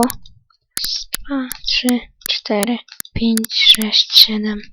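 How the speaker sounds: counting under the breath; whispered counting. Real, not acted. Counting quietly while playing. (szeptem liczy)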